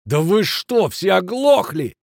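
high-pitched angry yell; losing his temper. A narrator's reading. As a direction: yell at them as if they're deaf. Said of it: "выходя из себя, заорал Кранер". (сердитый крик, выходя из себя)

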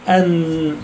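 "أن" with the n held as a nasal hum; ghunna on noun. Shown as a demonstration. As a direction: let the n of "أن" ring through the nose. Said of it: "معاه غنة".

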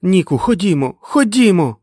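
high, loud pleading cry; screaming. A narrator's reading. Fran pulls Nick by the shoulder, screaming. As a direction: urge him away in a rising yell. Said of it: "кричала Френ".

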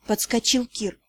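plain reading voice

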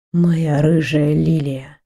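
low, slow, purring endearment; ardent murmur. A narrator's reading. Man ends his endearments to his lover, low ardent murmur. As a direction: draw it out low and slow, with heat. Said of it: "закончил его жаркий голос".

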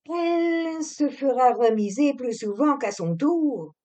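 put-on high, prim old manservant; disapproving old servant. A narrator's reading. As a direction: sniff at it like a fussy butler. Voices old Émile, disapproving. (vieux domestique réprobateur, voix haute)

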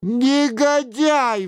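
high, drawn-out outraged exclamation; the king's indignant cry. A narrator's reading. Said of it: "вскричал король".

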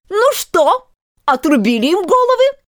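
high, imperious queen's shout; shouted question. A narrator's reading. Queen checking on her soldiers, shouted question. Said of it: "крикнула королева".

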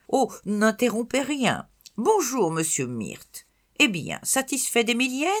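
high, loud society lady; grand old lady. Acted. Grandmother sweeps in. (grand-mère mondaine, voix haute et forte)

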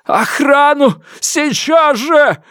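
high, sobbing shout for guards; panicked shout. A narrator's reading. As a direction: scream it, near tears, drawn out. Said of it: "выкрикнул он и сполз по стене, содрогаясь от рыданий".

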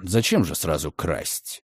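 low, soft, slow male voice; amused gentleman. Acted. Redfern brushes off the accusation, low and lazy. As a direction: shrug it off lazily.

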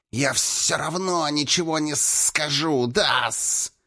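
high snake voice with drawn-out sibilants; defiant hiss. A narrator's reading. Captured snake king defies his captor, insolent hissing. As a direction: hiss back insolently like a serpent. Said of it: "дерзко прошипел Хис".

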